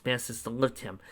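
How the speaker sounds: usual speaking voice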